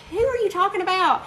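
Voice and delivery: high-pitched incredulous question; puzzled retort. Retold or imitated. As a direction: act out being baffled, pitch jumping up. Retells puzzled question to friend.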